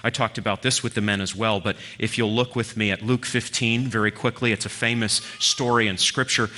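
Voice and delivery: ordinary talk, nothing marked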